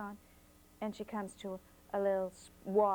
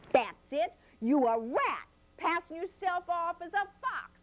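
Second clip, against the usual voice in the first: put-on accusing girl; 8 semitones higher, 4 dB louder than usual. A narrator's reading. Flossie accuses fox.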